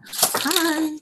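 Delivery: card opener's greeting. Acted. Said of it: "So you open it and you go".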